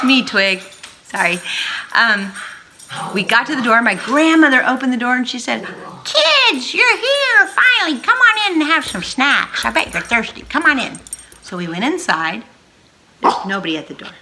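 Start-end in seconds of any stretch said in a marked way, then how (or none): 6.0-11.0 high, loud delighted grandma. Acted. Grandmother greeting the grandkids at the door, high and delighted. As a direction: welcome the kids like an excited grandma.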